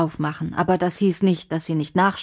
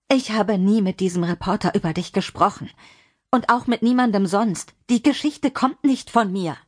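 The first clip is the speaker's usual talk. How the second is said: insist, growing more urgent; pleading denial; 3 semitones higher than usual. Acted. Lina swearing to John she never talked, pleading.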